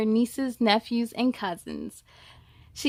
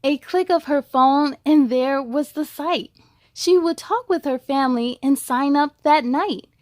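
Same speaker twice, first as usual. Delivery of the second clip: louder, rhythmic read-aloud voice; story narration; 6 dB louder than usual. A narrator's reading. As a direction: read the rhymes to kids with bounce. Reads rhyming picture book.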